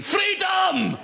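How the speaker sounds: high, drawn-out rallying shout; battle cry. Acted. Leader charging into battle, shouted battle cry. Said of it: "behind someone shouting".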